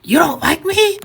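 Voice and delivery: high-pitched mock shout; retold shout. Rejected customer to bartender before running out crying, mock shout. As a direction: imitate a hurt, high-pitched outburst.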